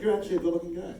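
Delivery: high, loud delighted surprise, laughing; amazed compliment. Retold or imitated. To the actor out of makeup, amazed and laughing. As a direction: marvel at his looks. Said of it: "I was like".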